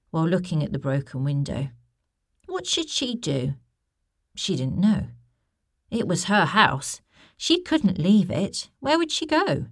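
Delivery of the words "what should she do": ordinary delivery, nothing marked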